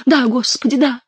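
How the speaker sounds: high, eager mock-penitent voice; hammed-up humble agreement; hurried babbling. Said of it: "зачастил Шико".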